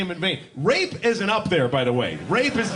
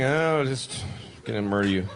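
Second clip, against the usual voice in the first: deadpan dad; 10 semitones lower than usual. Acted. Father casually answering kid.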